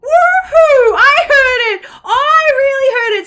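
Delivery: high, loud laughing shout; excited shout. A narrator's reading. Olive thrilled to hear Albert, joyful shout. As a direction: whoop with joy. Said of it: "feathers all afluster".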